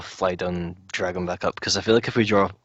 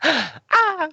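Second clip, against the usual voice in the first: high gasp and squeal; gasp, then squeal away; mock gasp; 5 dB louder than usual. Sarah flies away startled.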